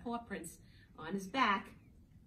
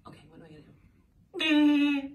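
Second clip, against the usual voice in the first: higher, louder, slow goofy voice; funny face voice; 6 semitones higher, 15 dB louder, slower than usual. Shown as a demonstration. Teacher pulling a funny face for the kids. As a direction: ham it up while pulling a face. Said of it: "Make a funny face".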